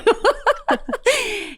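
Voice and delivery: high-pitched burst of laughter; real amused high laugh. Real, not acted. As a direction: laugh high at your own teasing. Amused after mocking co-host.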